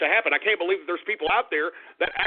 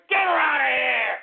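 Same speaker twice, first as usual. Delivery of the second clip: loud, higher furious shout; angry shout; 5 semitones higher, 4 dB louder than usual. Acted. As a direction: bark him off the air. Host ejects caller angrily.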